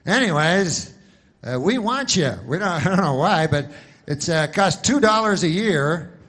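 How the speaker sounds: loud spoof ad spokesman; mock insurance pitchman. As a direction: belt out a cheerful-sell parody of an insurance ad. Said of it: "now it's Alex Trebek or something, but it's always like".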